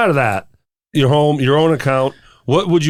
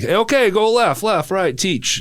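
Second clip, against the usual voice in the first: line dance caller; 8 semitones higher, slower than usual. Retold or imitated. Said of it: "those teachers would get up on stage".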